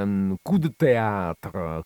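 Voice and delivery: slow, drawn-out French coup de théâtre; un coup de théâtre in a mock French accent. Shown as a demonstration. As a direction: savour the French phrase slowly. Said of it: "come dicono i francesi".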